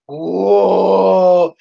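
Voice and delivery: drawn-out goofy roar; imitated dinosaur roar. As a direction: bellow like a pretend T-Rex. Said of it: "And he's going".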